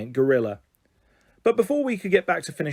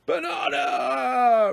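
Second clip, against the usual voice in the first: high, long-drawn roar; hungry gorilla calling out; giant gorilla roar; 10 semitones higher, much slower than usual. A narrator's reading. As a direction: stretch each vowel into a slow animal bellow. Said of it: "roared the giant gorilla".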